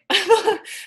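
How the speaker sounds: a bright burst of laughter after the clip; real laugh. Real, not acted. Vlogger laughing after watching her favorite speaker.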